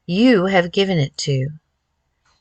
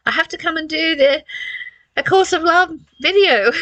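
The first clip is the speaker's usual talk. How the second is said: mock dutiful whine; 10 semitones higher, 3 dB louder than usual. Voicing her own sense of obligation.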